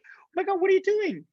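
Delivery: mock shock at bumping into an old friend; high excited disbelief; astonished. Retold shock at meeting Deborah.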